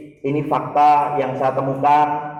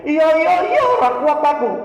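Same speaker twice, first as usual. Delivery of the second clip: much higher, louder yelp of pain; pained cry; 12 semitones higher, 3 dB louder than usual. Retold or imitated. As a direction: cry out that you can't bear it any more. Patient in pain during cupping.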